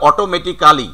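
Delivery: "অটোমেটিক্যালি" with a full "kaa" before "li"; every syllable of "অটোমেটিক্যালি" sounded; local "অটোমেটিক্যালি". Shown as a demonstration. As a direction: say "অটোমেটিক্যালি" as oto-meti-KAA-li. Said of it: "according to syllable or according to spelling".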